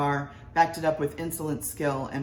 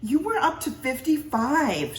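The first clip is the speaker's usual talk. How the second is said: higher, slow nagging wife; 10 semitones higher, 5 dB louder, slower than usual. A narrator's reading. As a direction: press the point like a worried wife. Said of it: "she said".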